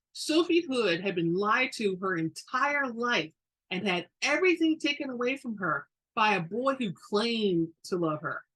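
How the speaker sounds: plain reading voice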